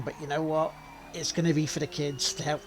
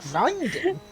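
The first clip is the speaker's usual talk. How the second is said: surprised; 12 semitones higher, 4 dB louder than usual. Real, not acted. Echoes the friend's word in disbelief.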